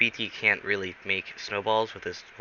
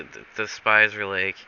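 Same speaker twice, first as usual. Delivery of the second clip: ordinary delivery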